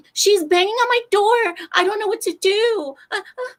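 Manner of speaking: high, loud frantic woman; mock panicked neighbour. Neighbour telling the front desk, high frantic squeal. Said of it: "She's like".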